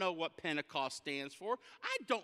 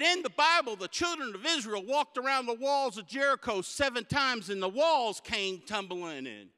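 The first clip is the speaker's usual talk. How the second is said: higher, louder mock-preachy old man; old man preaching; 5 semitones higher, 6 dB louder than usual. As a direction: declaim it like a pulpit elder. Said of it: "And he said".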